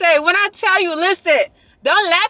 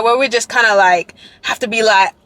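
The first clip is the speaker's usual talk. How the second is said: ordinary delivery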